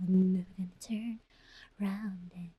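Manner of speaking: unvoiced whisper of the lyric. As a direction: whisper the song line. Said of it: "A S M R". (pabulong na kanta)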